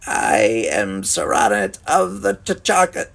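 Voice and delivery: slow, loud rasping alien voice. A narrator's reading. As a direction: announce it slowly in a rasp. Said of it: "was little more than a rasp".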